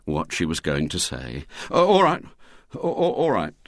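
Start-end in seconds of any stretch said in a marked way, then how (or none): 1.7-3.6 flustered, stammering surrender; higher, panicky climb-down; William, hastily. A narrator's reading. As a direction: give in at once, tripping on the words. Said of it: "he said hastily".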